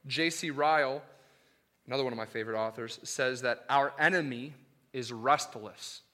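normal talking voice

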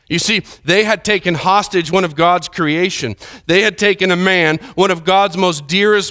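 high, emphatic pulpit delivery; impassioned preaching. Acted. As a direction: preach it with building force. Preaches at full force.

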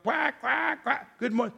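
high quacking duck greeting. Retold or imitated. Preacher voicing the duck greeting Grandma, high quacking.

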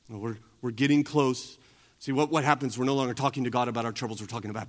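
ordinary talk

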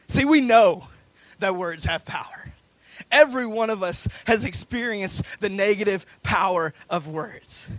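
emphatic preaching. Real, not acted. Preacher urging the church that words have power, emphatic preaching. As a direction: drive each phrase home to the congregation.

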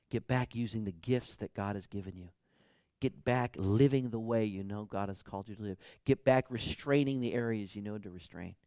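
ordinary talk, nothing marked

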